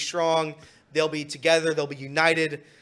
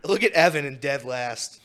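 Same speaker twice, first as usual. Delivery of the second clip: laughing through the words; giggling at the last-place runner; real laughter while talking; 9 dB louder than usual. Real, not acted. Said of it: "Sorry, Evan".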